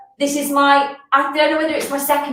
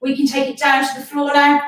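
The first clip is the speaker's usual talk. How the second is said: ordinary talk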